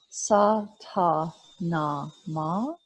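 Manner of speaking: Sa Ta Na Ma spoken slowly, each syllable separate; mantra syllables. Shown as a demonstration. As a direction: spell out Sa Ta Na Ma slowly and evenly. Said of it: "Sa Ta Na Ma has four syllables".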